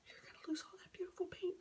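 softly mimicking worried viewers; mock gasped worry, kept hushed; imitated hushed dismay. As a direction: imitate onlookers fretting in a near-whisper. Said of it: "people are always like".

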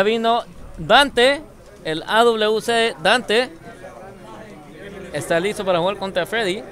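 lively esports caster voice; play-by-play commentary. Acted. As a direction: call the match as it happens. Live match commentary.

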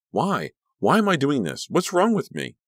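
high, climbing panic; distraught questioning. A narrator's reading. Danielle mid-fight, horrified at herself, distraught. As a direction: plead with yourself, pitch soaring.

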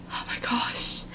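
barely audible, whispered reaction; stunned whisper. Real, not acted. Reader reacting to the tense chat story, stunned whisper. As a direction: whisper it under your breath.

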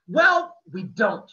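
sharp, loud, high snap; embarrassed boy snapping back at friends; snapped. A narrator's reading. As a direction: bite the words off defensively. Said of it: "I snapped".

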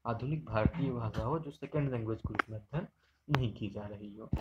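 ordinary talk, nothing marked

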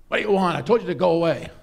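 loud exasperated shout; angry innkeeper. Acted. Innkeeper shouting at the persistent traveller, loud and exasperated. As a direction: drive him off for good. Innkeeper yells once more.

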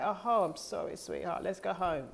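soft, consoling parent voice; parenting herself tenderly. Acted. Speaker comforts herself as her own parent, soft and tender. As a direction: soothe gently, as to a hurt child. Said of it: "I parent myself".

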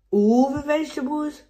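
high, loud disbelieving question; dismayed surprise. A narrator's reading. Pepper staring at the huge piles, surprised. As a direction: gasp at the size of the job. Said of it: "asked Pepper".

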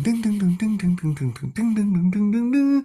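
sung ding ding rising to a high squeak; guitar ding imitation. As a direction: mimic the intro guitar pings. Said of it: "it kicks off with that ding".

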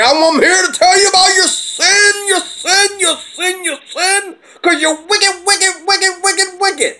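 high, hammered-up hellfire preacher chant; mock street preacher. Mocking a street preacher yelling at strangers, high-pitched sing-song condemnation. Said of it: "You don't just go out there and talk to people like that".

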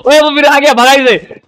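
high, loud warning yell; urgent shout to drive someone off; alarmed call-out. Real, not acted. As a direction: sound the alarm to your teammate. Alarm as intruder returns in game.

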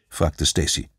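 usual speaking voice

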